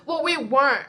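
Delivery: mild mock yell in self-defence; retold screaming. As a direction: act out yelling back, half-strength. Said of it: "I was screaming at her".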